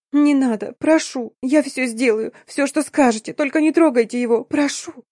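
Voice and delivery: high, tearful begging in gasped pieces; bawling, broken pleading. A narrator's reading. Collapsed girl begs the boss to spare him, sobbing high. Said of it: "сбивчиво лепеча".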